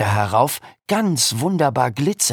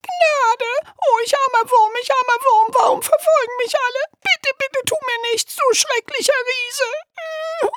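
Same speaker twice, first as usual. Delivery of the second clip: very high whimpering plea; squeaky piglet voice. Acted. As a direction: beg for mercy like a tiny piglet.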